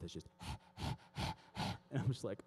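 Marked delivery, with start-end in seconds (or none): 0.3-1.1 heavy voiceless panting like a dog; imitated dog pant. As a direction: pant open-mouthed in quick breaths. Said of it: "he's got that dog breath".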